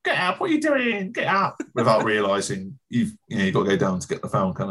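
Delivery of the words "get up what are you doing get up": high-pitched scolding mother-in-law; mother-in-law character. Acted. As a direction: nag the fallen player in a squeaky put-on voice. Acting the mother-in-law watching football.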